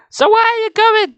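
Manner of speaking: put-on very high girlish question; little sister. A narrator's reading. Nicole asking Dad about the trip, high-voiced. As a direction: ask in a high-pitched sister voice.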